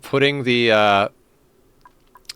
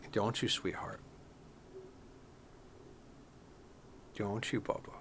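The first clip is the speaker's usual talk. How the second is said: cooing to cat; 17 dB quieter, slower than usual. Real, not acted. Streamer coos to the cat on his shoulder, soft and tender. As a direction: murmur tenderly to the cat right by your ear.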